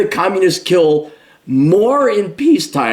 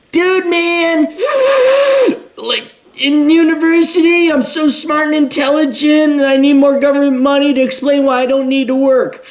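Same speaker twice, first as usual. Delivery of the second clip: much higher, shouted whiny brat voice; mock whiny student; 11 semitones higher than usual. Commentator mocking a millennial socialist, high shouted whine. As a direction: lampoon a smug student begging for money.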